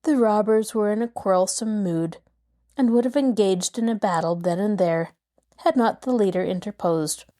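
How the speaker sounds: ordinary delivery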